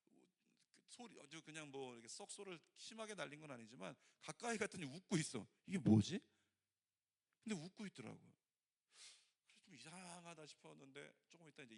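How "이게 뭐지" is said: loud, sudden what-is-this; puzzled. Retold or imitated. Pastor finding the crying sister laughing, loud puzzled blurt. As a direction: blurt it out in surprise.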